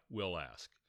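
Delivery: usual speaking voice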